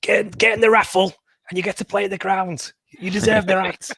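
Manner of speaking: high-pitched, put-on impression; hammed-up mimic's voice; mock rallying call pitched well up; Paul Cook. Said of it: "you need Paul Cook to say".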